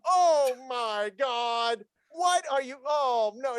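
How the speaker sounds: slow, high mock-shocked mom; a slow, high imitation of a startled mother; imitating mom. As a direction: drag out her fake shock, high. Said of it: "she would say things like".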